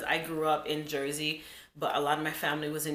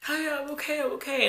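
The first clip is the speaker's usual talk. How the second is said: put-on little girl; 9 semitones higher, faster than usual. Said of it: "I was like".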